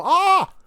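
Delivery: sharp high pained "ah" of a cringe; wince cry. Shown as a demonstration. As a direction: yelp like biting a fork. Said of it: "you physically wince".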